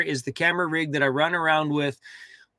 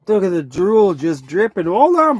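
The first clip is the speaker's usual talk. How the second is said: excited dog owner; 3 semitones higher, 8 dB louder than usual. Real, not acted.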